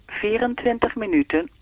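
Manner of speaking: even, measured time announcement; speaking clock voice. Acted. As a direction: read the time like the recorded clock. (zoals de sprekende klok)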